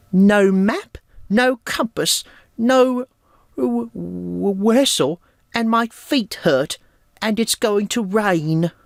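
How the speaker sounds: high, whiny cartoon complaint; whiny Tiger. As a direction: whine it out high like a sorry pup. Tiger's complaining line quoted.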